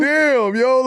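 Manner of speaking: high stunned yo, hammed-up; mock stunned reaction. [name] reacting to Kobe's rude answer, high mock stunned. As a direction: act floored by the answer.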